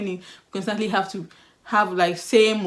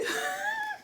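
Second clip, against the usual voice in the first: burst of laughter; 9 dB quieter than usual. Real, not acted. Laughs after the shouting.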